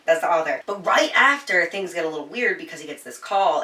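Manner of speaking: usual speaking voice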